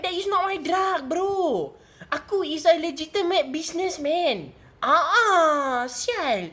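high-pitched, hammed-up Malay accent; Malay accent impression. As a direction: put on a protesting, exaggerated Malay accent. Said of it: "The Malay one standard".

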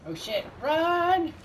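high, slow hammed-up panic; overacted alarm yell; mock panic. Friend caught in the storm.

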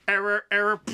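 glitching robot. Retold or imitated. Robot telling witness error.

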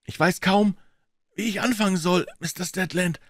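shy, nervous. A narrator's reading. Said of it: "setzte sich schüchtern".

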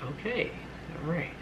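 high, soft, hesitant reaction; quiet disbelief. Retold or imitated. Alone in the kitchen taking it in, high and soft. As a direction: say it small and high, with pauses.

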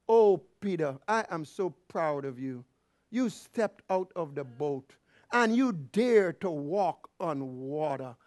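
low, soft, slow doting voice; mock tender praise. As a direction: gush gently like a proud teacher, put on to be disproved. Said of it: "Is that what Jesus said?".

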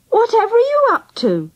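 high-pitched mum, taken aback; amused astonishment. A narrator's reading. Angela's mum finding the children dressed up, amused and astonished. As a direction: exclaim it high, in amused surprise.

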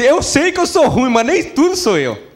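protesto agudo de injustiçado, meio cômico; diabo se defendendo. Acted. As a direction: reclamar em voz mais alta no tom, como quem se defende. Devil defends himself in joke. (diabo indignado de piada, em tom agudo)

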